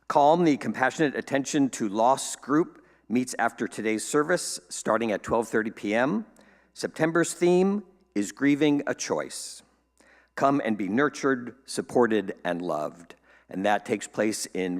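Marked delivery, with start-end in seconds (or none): none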